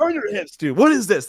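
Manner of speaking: high, loud mock coaching bark; fired-up coach correcting a fighter over FaceTime; coach yelling at fighter. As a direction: get on him like a frustrated coach. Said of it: "being like".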